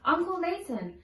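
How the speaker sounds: usual speaking voice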